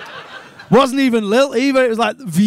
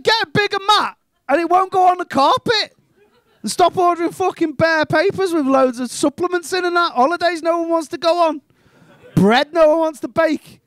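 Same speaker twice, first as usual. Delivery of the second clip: exasperated rant; 6 semitones higher than usual. Acted. Acted rant back at householder.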